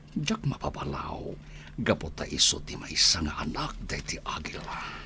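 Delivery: hushed, breathy whisper to the listener; whispered aside. Acted. Narrator confiding the son's fear to the audience in a whisper. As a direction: lean in and whisper it like a secret.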